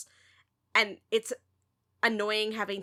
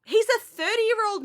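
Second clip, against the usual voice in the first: incredulous; 10 semitones higher, 5 dB louder than usual. Real, not acted. Incredulous at the TV plot.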